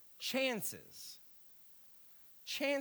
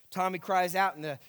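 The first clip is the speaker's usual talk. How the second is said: ordinary delivery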